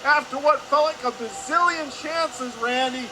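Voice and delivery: high, loud shout, holding its pitch through the goal; excited goal call. Real, not acted. Announcer calling a last-seconds goal to listeners, excited shout. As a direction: belt out the breakthrough goal at full voice.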